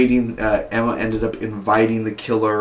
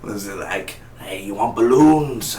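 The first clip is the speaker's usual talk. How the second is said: creepy clown whisper; 2 semitones lower, 3 dB quieter than usual. Retold or imitated. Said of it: "Uh, like".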